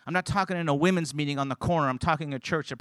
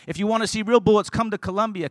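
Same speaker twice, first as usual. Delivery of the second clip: higher, driving restatement of a quote; emphatic preaching; 6 semitones higher, 5 dB louder than usual. Real, not acted. Preacher showing the congregation what a seed is, emphatic preaching. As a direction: proclaim it from the pulpit, pitched up.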